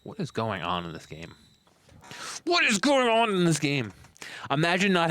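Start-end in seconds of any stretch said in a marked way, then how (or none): 0.0-1.3 low, soft muttering to oneself; quiet bafflement. Real, not acted. Baffled, first muttered take. 2.4-3.9 high-pitched, incredulous outcry; exaggerated bafflement. Real, not acted.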